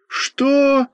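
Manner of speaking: very high-pitched outraged yelp; yelled in shock. A narrator's reading. Two schoolboys react to extra work, yelling in protest. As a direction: yell it out, voice shooting up. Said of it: "заорали мы с Костей в один голос".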